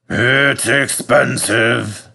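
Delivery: slow, musing fat controller; thoughtful. Acted. Fat controller to fireman, mulling the cost, slowly. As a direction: weigh the cost slowly aloud. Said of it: "said the fat controller thoughtfully".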